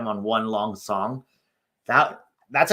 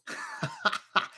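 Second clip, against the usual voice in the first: high, breaking-up giggle; laughing; 9 semitones higher, 6 dB quieter than usual. Real, not acted. Streamer finding his parody song hilarious, high laugh. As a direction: laugh at your own silly joke.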